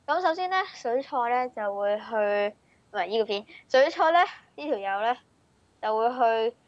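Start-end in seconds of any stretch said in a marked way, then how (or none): none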